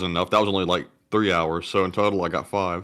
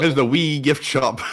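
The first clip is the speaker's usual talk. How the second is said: mock Scottish; 3 semitones higher, 4 dB louder than usual. Playing along in Scottish accent.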